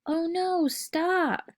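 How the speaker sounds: high pitched cry of alarm; scared yell. Acted. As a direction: yelp it as if the cart is on you. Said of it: "I yelled".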